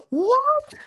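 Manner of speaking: stunned disbelief; shocked what. Acted. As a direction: inner cry of disbelief. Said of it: "berkata dalam hati saya".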